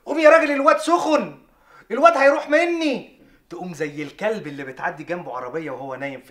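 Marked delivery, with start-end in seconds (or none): 0.1-3.2 high, loud frantic wife voice; panicked wife. Retold or imitated. Husband imitates wife waking him over sick child, high panicked cry. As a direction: shake him awake in a panic. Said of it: "ويقول لك".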